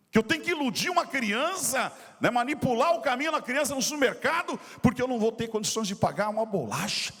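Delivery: mildly outraged, re-enacted complaint to God; indignant protest. Pastor questioning God over having no money, indignant protest. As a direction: protest with insistent, rising questions. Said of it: "aquele dia foi um dia de revolta".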